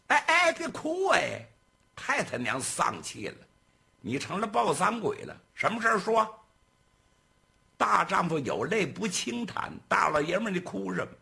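loud, high, exasperated scolding; scolding boss. Acted. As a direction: bark at him to stop crying. Boss scolds crying servant.

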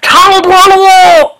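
long, high, drawn-out yell of the nickname; loud shout. A narrator's reading. Someone in the back yard yelling the nickname. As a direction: bellow the name across the yard. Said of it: "有人大喊一声".

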